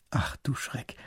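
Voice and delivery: breathless startled aside; shocked whisper. A narrator's reading. Grand duke whispering to his minister, startled. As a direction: whisper it in alarm.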